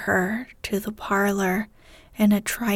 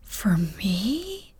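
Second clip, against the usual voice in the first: soft breathless question; breathless gasp; 4 dB quieter than usual. A narrator's reading. Beth stunned by the piano, soft gasp. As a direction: gasp it out, overwhelmed. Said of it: "gasped Beth".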